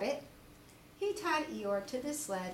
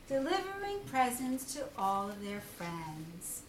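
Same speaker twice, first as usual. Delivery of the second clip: ordinary delivery, nothing marked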